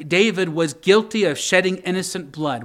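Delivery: ordinary delivery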